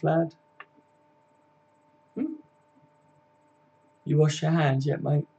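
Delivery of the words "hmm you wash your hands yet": ordinary talk, nothing marked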